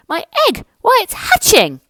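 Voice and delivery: thrilled the egg is hatching; high, excited elephant; excited shout. Acted. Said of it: "shouted Horton".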